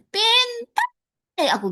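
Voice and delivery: high whiny pintar; jealous teacher. Retold or imitated. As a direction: whine it like a jealous mentor. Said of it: "gitu nih".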